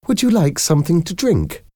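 high-pitched polite question; bright hostess offer. A narrator's reading. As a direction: pitch it up to smooth over an awkward moment. Hostess hurriedly changing the subject.

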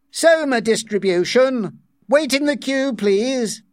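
loud, high call to a crowd; shouted announcement. A narrator's reading. Official to waiting cleaners, loud shout. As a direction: call it out over the queue. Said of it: "a loud voice shouted".

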